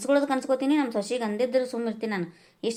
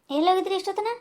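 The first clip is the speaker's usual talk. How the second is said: put-on higher voice of the young daughter-in-law; a different, higher woman's voice asking; 8 semitones higher than usual. Wife asks husband where he was.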